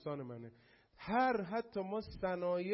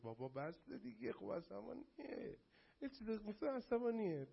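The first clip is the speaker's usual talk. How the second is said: fed-up mock sigh; 5 semitones higher, 5 dB quieter than usual. Mocking fed-up viewer.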